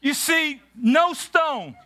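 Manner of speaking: high, driving preacher's cadence with shouted peaks; full-force preaching. Acted. Pastor preaches to the congregation at Grace Covenant, high and fervent. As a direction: preach it with rising fire.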